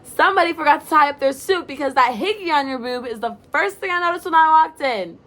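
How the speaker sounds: loud, high teasing shout. Acted. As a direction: yell it through a closed door at her roommate. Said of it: "she shouts from across the door".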